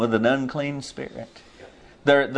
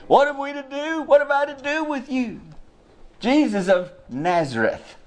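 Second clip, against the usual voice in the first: much higher, strained cry of a tormented spirit; demon crying out; 11 semitones higher than usual. Retold or imitated. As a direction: cry out high like a cornered demon. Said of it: "Cried with a loud voice".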